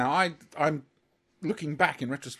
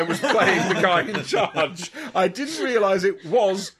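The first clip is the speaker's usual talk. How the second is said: loud, slow, higher, through laughter; laughing incredulity; 4 semitones higher, 8 dB louder, slower than usual. Real, not acted. Fan confesses misunderstanding to friends, real laughing incredulity. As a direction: struggle through laughter, punching out the words. Helpless laughter telling own mistake.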